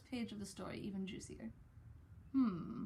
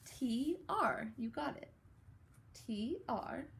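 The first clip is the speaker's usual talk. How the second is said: normal talking voice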